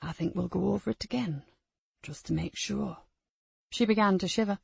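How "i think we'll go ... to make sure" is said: menacing quiet voice. Acted. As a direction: menace her in a hushed voice. Abuser menacing child Lucy.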